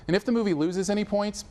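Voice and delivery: usual speaking voice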